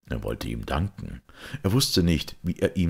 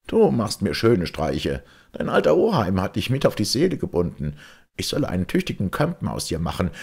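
put-on louder, higher teasing rebuke of an old captain; gruff mock scolding; 8 semitones higher, 4 dB louder than usual. A narrator's reading. Breitenstein scolding Georg half in jest, loud and bluff. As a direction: scold the boy with fond bluster.

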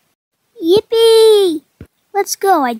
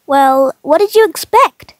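usual speaking voice

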